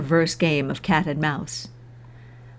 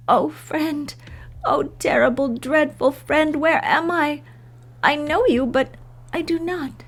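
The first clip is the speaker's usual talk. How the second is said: put-on much higher, trembling frightened girl; lost and pleading, half whispered; frail Anne; 8 semitones higher than usual. A narrator's reading.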